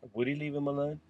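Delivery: ordinary talk, nothing marked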